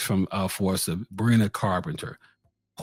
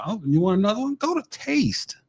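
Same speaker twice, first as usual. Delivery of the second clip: put-on excited little girl voice; 9 semitones higher than usual. Parroting a girl's request.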